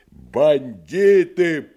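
slow, high, loud cry; shouting. Acted. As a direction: bellow the accusation. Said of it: "прокричал Иван".

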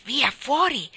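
a quick loud shoo; Mary Poppins shooing the lark away; urgent shoo. Acted. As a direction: push the bird out urgently.

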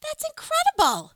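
loud, high-pitched astonishment; amazed. Real, not acted. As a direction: exclaim it high and loud, thrilled. Amazed by the prom fun fact.

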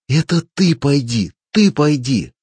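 high, loud repeated yell; furious shout. A narrator's reading. Klapos screams back at the advice to work, yelling. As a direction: throw it back in his face. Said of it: "закричал Клапос".

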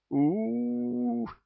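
high, far-off group wail; howling together. A narrator's reading. Ghouls wail in unison over their hangover, high howl. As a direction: howl in chorus, long and high. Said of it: "завыли все дружно в голос".

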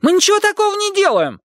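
put-on a boy insisting on his innocence; whiny protest. A narrator's reading.

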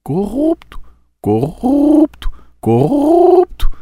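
slow, rising pigeon coo. Retold or imitated. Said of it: "imitando pombos".

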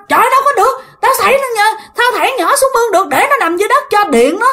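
high, fast excited explaining; agitated neighbor. Retold or imitated. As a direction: argue urgently over the commotion. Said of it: "cái người đó nói vậy". (người hàng xóm cãi gấp, giọng cao nhanh)

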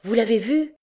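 quick, eager cry of hope; eager exclamation. A narrator's reading. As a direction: burst out with lively hope. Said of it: "s'écria Miette avec vivacité". (s'écrie avec vivacité)

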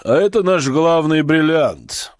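slow, loud showman's reveal; booming circus ringmaster. A narrator's reading. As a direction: present the star like a compère. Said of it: "как конферансье".

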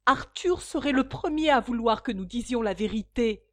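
firmly. A narrator's reading. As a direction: say it firmly, not backing down. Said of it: "répondit la fille avec fermeté".

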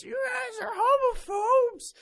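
hammed-up outraged shout; mock angry commenter. Mocking imagined angry commenters.

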